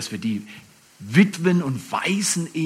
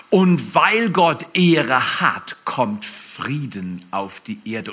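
ordinary talk

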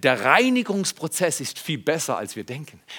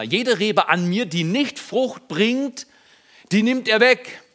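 slow, rising, solemn reading of the verse; scripture reading; 7 semitones higher, 4 dB louder, slower than usual. Real, not acted. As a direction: read the verse out slowly. Reading Jesus' words. (langsam, feierlich vorgelesen)

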